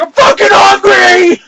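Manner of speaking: high, loud, drawn-out scream; hammed-up hungry wail; comic desperate yell. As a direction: bellow it in mock anguish. Acted absurd shout when slammed.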